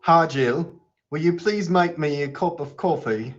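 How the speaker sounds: put-on American doing an amateur English accent; attempted English accent. Host imitates his British guest's sentence, attempted English accent. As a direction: parrot it back in a careful English accent. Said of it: "I'll try and imitate what you do".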